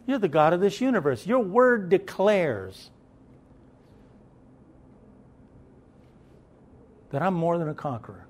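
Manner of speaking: a lightly voiced, earnest prayer to the Father; sample prayer. Shown as a demonstration. Preacher showing the church how to pray, lightly voiced as a prayer. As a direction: model a simple heartfelt prayer, slightly hushed. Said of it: "Tell God".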